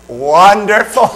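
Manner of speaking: slow, laughing fake cheer; sarcastic dad. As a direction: overdo the enthusiasm you don't feel. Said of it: "you know what Dad says".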